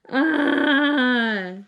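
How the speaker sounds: loud, long "aah" of exasperation; frustrated yell. Real, not acted. Reader maddened by the ending, frustrated yell. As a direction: let out a drawn-out frustrated cry. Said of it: "I'm so mad".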